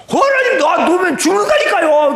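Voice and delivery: high loud pleading shout; desperate shout. Retold or imitated. Deacon shouting to God that letting go means death. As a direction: yell the plea up at God.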